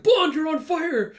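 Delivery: high, loud, drawn-out warning yell; alarmed shout. Retold or imitated. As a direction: shout a panicked warning to the dog. Alarmed warning, dog is burning.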